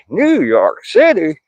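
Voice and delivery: high, slow New York City drawl; New York accent. Shown as a demonstration. Reading a viewer's city aloud in a New York accent. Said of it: "Sorry, I had to do that".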